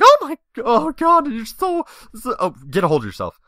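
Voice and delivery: very high, loud, slowed swooning exclamation; flustered gasp. Retold or imitated. Seeing the guy's abs, a high loud swoon. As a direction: swoon over him, then snap out of it.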